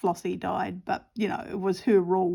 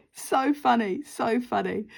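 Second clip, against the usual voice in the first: laughing; 4 semitones higher, slower than usual. Real, not acted. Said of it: "I'm laughing about it".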